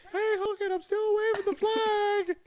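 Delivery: very high, loud squeaky impression; mock voice. A podcaster mocking a wrestler in a very high, loud squeak. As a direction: squeak it out like a cartoon.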